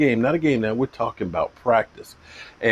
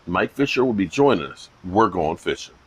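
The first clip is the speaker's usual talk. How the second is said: usual speaking voice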